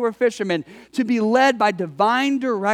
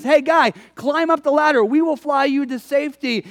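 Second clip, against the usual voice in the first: helicopter rescuer shouting; 5 semitones higher, 4 dB louder than usual. Acted.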